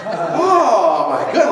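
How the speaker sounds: normal talking voice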